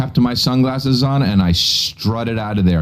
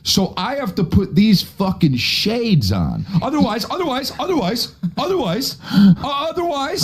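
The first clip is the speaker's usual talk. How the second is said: hammed-up whine; 12 semitones higher than usual. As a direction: whimper it like a fragile diva. Whiny repeated otherwise.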